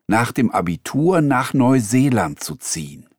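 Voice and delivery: ordinary talk, nothing marked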